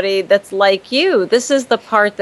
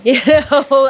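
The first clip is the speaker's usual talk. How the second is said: higher-pitched, chuckling aside; laughing; 5 semitones higher, 4 dB louder than usual. Real, not acted. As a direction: laugh at your own dark joke. Laughs after Grim Reaper joke.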